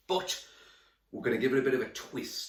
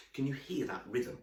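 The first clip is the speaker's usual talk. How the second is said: usual speaking voice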